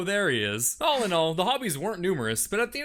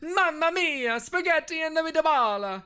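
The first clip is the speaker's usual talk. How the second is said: mock Italian; 7 semitones higher, slower than usual. Patient does Italian.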